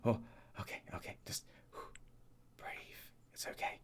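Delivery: whispered, breathy self-reassurance; panicked self-soothing. Acted. Game master voices the panicking wizard calming down, hushed whisper. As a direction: whisper to yourself to calm down. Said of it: "still panicking".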